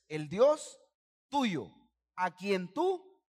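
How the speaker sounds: loud, drawn-out chant of the verse; slow unison reading. Shown as a demonstration. Pastor leading the congregation in the verse, loud and slow. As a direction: stretch every word out for the crowd to follow. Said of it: "lea esta frase conmigo".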